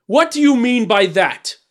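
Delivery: loud, high, angry question; fierce demand. A narrator's reading. Tyrant rounding on the dwarf, fierce. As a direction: challenge him furiously. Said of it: "cried the tyrant fiercely".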